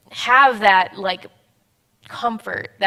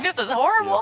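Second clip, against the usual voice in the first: laughing self-mockery; 9 semitones higher, faster than usual. Retelling her own thoughts while crying.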